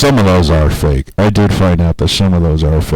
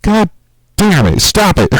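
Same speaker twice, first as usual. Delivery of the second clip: high exasperated plea; mock frustration; 13 semitones higher than usual. To the FBI deleting his pictures, high exasperated complaint. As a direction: whine it out pitched up.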